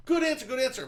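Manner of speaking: high-pitched game show encouragement; cheering teammate. Retold or imitated. Mimicking families on Family Feud, high encouraging call. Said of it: "everyone goes".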